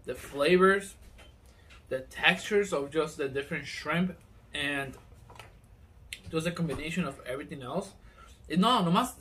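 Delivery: speaking between bites; talking while chewing. Real, not acted. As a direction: mouth partly full of food. Seen 0: Bit the tostada just before; mouth works while talking, hand at mouth.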